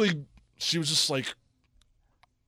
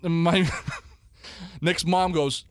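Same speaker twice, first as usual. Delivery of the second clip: ordinary talk, nothing marked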